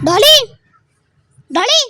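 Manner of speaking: repeated rising calls to Dad; insistent call for Dad's attention. Real, not acted. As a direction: call Dad over, pitch sweeping up. Calling dad repeatedly to come.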